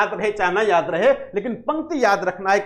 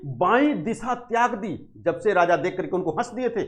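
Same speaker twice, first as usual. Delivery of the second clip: usual speaking voice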